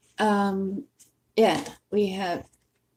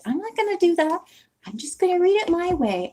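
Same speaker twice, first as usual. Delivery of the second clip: high-pitched mock-stubborn self-quote; hammed-up, higher voice of stubborn resolve; playfully defiant; 9 semitones higher, faster than usual. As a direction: declare it high and singsong, acting out a decision. Acting out her own defiant decision.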